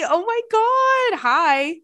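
very high, loud, drawn-out greeting; delighted squeal. Real, not acted. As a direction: squeal with excitement at a surprise fan. Thrilled that the friend listens.

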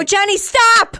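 high, loud cry to intervene; retold shout. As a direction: re-enact yelling at a friend to stop. Retelling her own shouted intervention.

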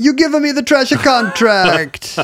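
high, hammed-up mock Italian accent; bad Italian accent. Shown as a demonstration. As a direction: threaten in a terrible, singsong Italian accent. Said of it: "but you do an Italian accent".

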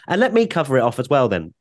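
usual speaking voice

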